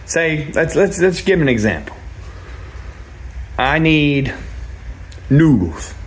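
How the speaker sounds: ordinary delivery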